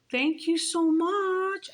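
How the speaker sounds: high, slow, drawn-out thanks; stretched-out, high-voiced appreciation; imitated gratitude. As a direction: mimic his gushing thanks way up high.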